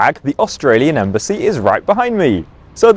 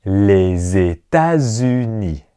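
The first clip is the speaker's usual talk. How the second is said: Les États-Unis slow with the liaisons; slow teacher model of Les États-Unis; 4 semitones lower, slower than usual. Shown as a demonstration. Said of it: "So répétez".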